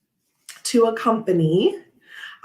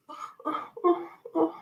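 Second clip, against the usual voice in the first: breathy, soft fake sobbing; hushed, high mock crying sounds; imitating mock sobs; 10 semitones higher, 11 dB quieter than usual. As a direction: mimic a toddler's happy whimper. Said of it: "And he's like".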